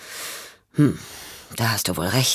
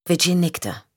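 plain reading voice

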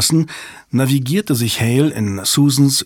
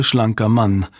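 plain reading voice

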